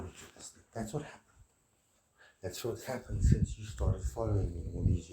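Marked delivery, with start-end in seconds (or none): 0.0-0.6 hushed unvoiced reply; whispered echo. Shown as a demonstration. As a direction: drop to a whisper. Repeated line, voiced then whispered.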